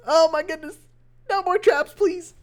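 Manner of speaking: real alarm at the trap; excited outburst. Real, not acted. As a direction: caster yelps as an air bomb appears. Caster reacts to surprise air bomb.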